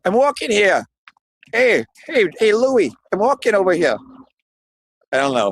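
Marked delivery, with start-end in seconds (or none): 0.1-2.9 a hint of New York accent, higher and pushier; hammed-up New York "I'm walking here" guy; light New York cabbie impression. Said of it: "[name] doing his New York impression". 3.1-4.0 put-on a light New York accent on "walking"; lightly voiced New York guy calling "I'm walking over here"; mild New York impression. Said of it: "doing his New York impression".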